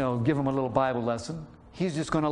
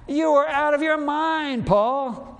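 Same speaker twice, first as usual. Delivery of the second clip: high, loud accusing shout; a furious governor cutting in loud and high; 12 semitones higher, 6 dB louder, slower than usual. Acted. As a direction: yell it at him like he's lost his mind. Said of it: "he shouted".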